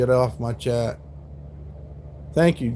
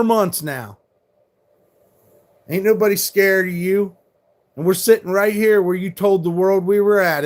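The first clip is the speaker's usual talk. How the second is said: angry; 4 semitones higher than usual. Real, not acted. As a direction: rant hard at the trolls. Ranting angrily at doxxing trolls.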